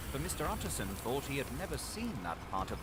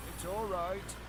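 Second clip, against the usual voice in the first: butler; 10 semitones higher than usual. A narrator's reading. Butler reassures the servants.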